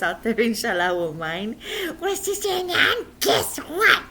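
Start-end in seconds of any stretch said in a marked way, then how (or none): 2.0-4.0 put-on a lightly high puppet boy; teasing guess-what. Puppet boy teases his teacher, lightly high.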